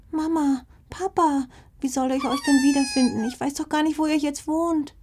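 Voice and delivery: a lost little fox cub whimpering for her parents. Acted. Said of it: "winselte plötzlich". (hohe, winselnde Fuchskinderstimme)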